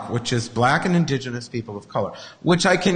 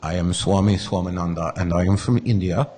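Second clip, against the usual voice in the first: low, mock Indian swami accent; amateur Indian accent, pitched low; attempted Indian accent; 9 semitones lower than usual. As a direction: try on an Indian guru's accent. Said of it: "I can't do the accent. I'm gonna try".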